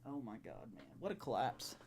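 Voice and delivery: muttered under the breath in disbelief; soft, hollow disbelief; stunned murmur. Real, not acted. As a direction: say it almost to yourself, dazed. Stunned after season-ending loss.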